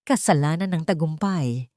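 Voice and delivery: plain reading voice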